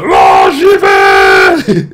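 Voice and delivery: loud drawn-out roar, mimicking a fired-up buddy; long, hammed-up battle roar; eager mad friend. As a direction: imitate a gung-ho mate charging in. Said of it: "le mec, il en veut, tu sais".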